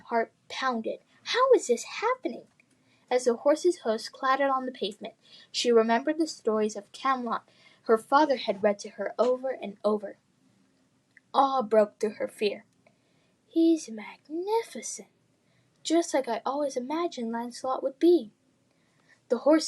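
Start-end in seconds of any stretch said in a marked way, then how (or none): 1.2-2.5 high breathless wonder; amazed. Acted. Lily amazed at the knight, high wonder. 13.5-18.3 awed whisper. Acted. Lily in awe.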